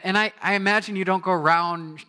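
ordinary delivery

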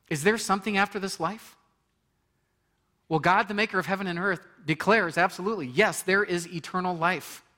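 high, loud impassioned preaching; full-force preaching. Real, not acted. Preacher proclaiming eternal life to the congregation, fervent. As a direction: build to the climax of the sermon.

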